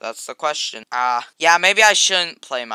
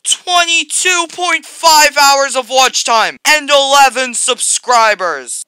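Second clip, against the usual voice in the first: much higher and louder, slow and amazed; excited brag; 9 semitones higher, 9 dB louder, slower than usual. Real, not acted. Showing off his top video's stats, thrilled and loud. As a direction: announce the big number like it's unbelievable. Bragging about video watch time.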